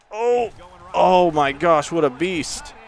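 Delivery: high, rising cry of awe; amazed excitement. Real, not acted. Gamer reacts to a beast of a player, thrilled. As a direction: marvel loudly at a huge play.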